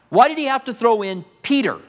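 ordinary talk, nothing marked

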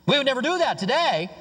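ordinary talk

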